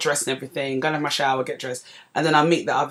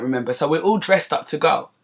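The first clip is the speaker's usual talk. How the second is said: usual speaking voice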